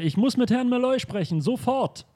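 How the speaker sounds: normal talking voice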